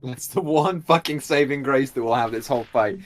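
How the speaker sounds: ordinary talk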